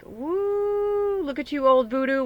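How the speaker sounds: high delighted woo; excited whoop. Real, not acted. Reader reacting to a card she drew, high excited whoop.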